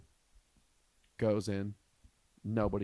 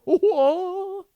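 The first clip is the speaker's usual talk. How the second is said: a huge, much higher and louder cry of whoa; dad stunned by what his son just saw; shocked whoa; 14 dB louder than usual. Retold or imitated. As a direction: let it burst out in disbelief. Shocked at son's reaction.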